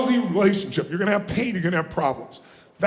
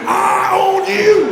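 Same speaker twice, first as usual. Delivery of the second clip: slow, loud and higher, fully committed; mean it; 9 semitones higher, 8 dB louder, much slower than usual. Shown as a demonstration. Challenging a neighbour, slow loud declaration. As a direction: declare it like you truly mean it. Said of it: "say it like you mean it".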